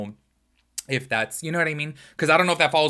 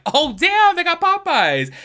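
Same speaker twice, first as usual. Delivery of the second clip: much higher, loud thrilled exclamation; excited surprise; 9 dB louder, slower than usual. Retold or imitated. Vlogger imagining finding Popeyes abroad, thrilled squeal. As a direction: squeal with delight at the find. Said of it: "I'm gonna be like".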